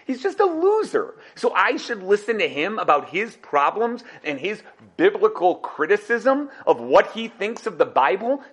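ordinary talk

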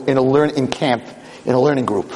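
normal talking voice

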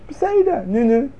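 a careless okay, sure, sure; breezy mock indifference; dismissive shrug. Dismissive reply to God's command.

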